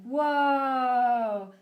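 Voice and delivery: stretched mock amazement; amazed. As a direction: gasp out a drawn-out wow of awe. Dolls' viewers amazed in pitch.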